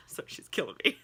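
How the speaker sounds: whispered through a laugh; breathy laugh while talking; laughing at the dog. Real, not acted.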